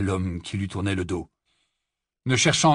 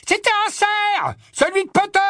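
shouted accusation; 6 dB louder than usual. A narrator's reading. Death Eater accusing the barman over Potter's patronus, shouting.

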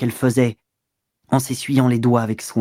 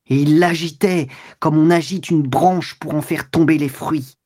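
usual speaking voice

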